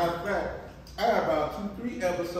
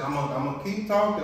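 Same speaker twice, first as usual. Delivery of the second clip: normal talking voice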